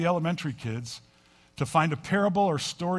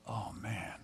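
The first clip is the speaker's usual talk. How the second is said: muttered dread; 6 semitones lower, 12 dB quieter than usual. Retold or imitated. Mother dreading the foot washing.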